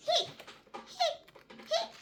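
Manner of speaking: small high hics; tiny hiccup sounds. Acted. Little Pig still hiccupping, small high hics. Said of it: "says Little Pig".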